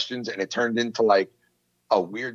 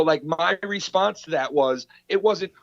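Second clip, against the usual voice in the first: ordinary talk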